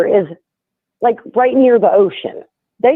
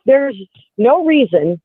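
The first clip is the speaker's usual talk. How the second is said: ordinary talk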